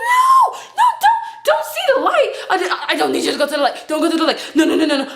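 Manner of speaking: high, hurried "no, no" plea; comic frantic protest with laughter in it; mock panicked plea. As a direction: beg in hammed-up panic, starting way up high. Acts own panicked plea.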